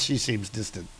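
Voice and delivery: dry deadpan, dropped low and soft. Real, not acted. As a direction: undercut the line, flat and quiet. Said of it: "maybe because she's freaking unconscious".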